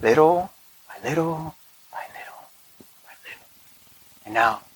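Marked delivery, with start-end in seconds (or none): 0.0-3.5 whispered. Shown as a demonstration. Preacher whispers the gradual approach to his congregation. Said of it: "whispered repetition".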